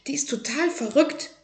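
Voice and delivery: high, unvoiced-sounding whisper; whispered aside. A narrator's reading. Tim to his mother about the librarian, whispering. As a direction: whisper it like a scandalous secret.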